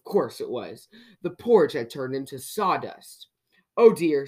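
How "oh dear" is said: loud, mildly spluttering dismay; spluttered. A narrator's reading. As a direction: blurt it in dismay. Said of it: "spluttered Mister Mischief".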